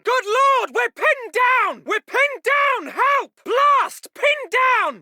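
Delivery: very high, loud cries of alarm; alarmed shouting. Acted. Officer pinned down and calling for help, shouting in alarm. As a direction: yell for help under fire.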